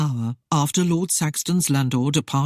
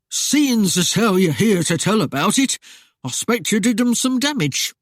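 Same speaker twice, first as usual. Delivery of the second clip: higher, louder country mayor, impressed; rustic mayor; 5 semitones higher, 4 dB louder than usual. A narrator's reading. Mayor hearing of the highwaymen, impressed. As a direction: fawn a little in a yokel voice.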